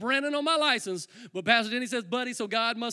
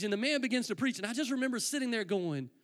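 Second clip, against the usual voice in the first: ordinary talk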